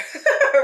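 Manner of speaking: bright burst of laughter; short laugh. Real, not acted. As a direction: let out a quick laugh. Laughs at own nose.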